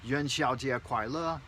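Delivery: "Yuan Xiao Jie Kuai Le" said carefully in Mandarin, tones and all; Mandarin greeting. Shown as a demonstration. Teaching viewers the festival greeting in Mandarin. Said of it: "make sure you say it like this".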